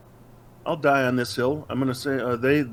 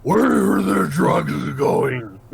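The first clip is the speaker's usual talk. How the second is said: slow, higher, louder mock growl of a brooding hero; 6 semitones higher, 5 dB louder, much slower than usual. Asked to do Batman, he answers in a slow mock growl. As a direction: drag each word out like a hammy caped crusader.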